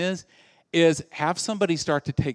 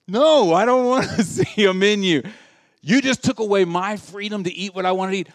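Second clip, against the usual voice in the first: mock outraged customer; 4 semitones higher, 4 dB louder than usual. Customer denied his burger.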